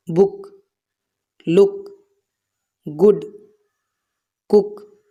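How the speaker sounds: बुक, लुक, गुड, कुक with a short "u" vowel; short U sound. Shown as a demonstration. Teacher showing learners double O, short "u" in बुक, लुक, गुड, कुक. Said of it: "छोटी ऊ की तरह से".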